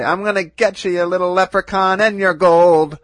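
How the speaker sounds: loud villain threat, rising to a yell; pantomime villain. Pretend. Cohost playing villain to the leprechauns, loud gleeful threat. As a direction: menace them like a storybook villain. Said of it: "I wanna play a villain".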